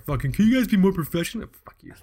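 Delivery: hammed-up annoyed scolding; mock angry. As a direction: playfully fake outrage. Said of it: "I'm very angry".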